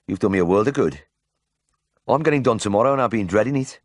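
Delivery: relieved Brummie patient; grateful confession in broad Birmingham; Birmingham accent. A narrator's reading. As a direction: pour out relief in ripe Brummie. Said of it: "in a ripe Birmingham accent".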